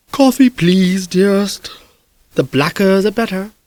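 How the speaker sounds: lightly voiced old man wanting coffee; sleepy old doctor. Doctor Lysander orders coffee.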